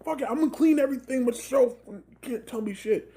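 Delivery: high, ranting parent; mock outburst of a fed-up parent; freak-out rant. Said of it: "little freak out rant".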